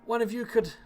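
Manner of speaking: hurt villager calling for aid; wounded man calling out. Acted. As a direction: plead in a high, halting voice. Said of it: "calls out".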